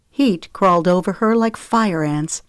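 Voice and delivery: plain reading voice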